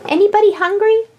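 high, eager group clamor; excited. Pretend. Hungry household is offered food, excited high voices.

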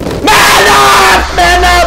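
very high, loud scream; panicked self-urging. Real, not acted. Terrified player ordering himself to man up, screamed. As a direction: scream it to force courage.

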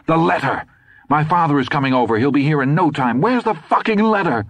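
high, fast frantic yelling; desperate, rushing to find it; panicked demand. A narrator's reading. Todd panicking at Dusander before father arrives, frantic yell.

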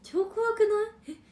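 shocked. Real, not acted. Reacting to bear encounter story.

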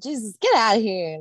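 ordinary delivery

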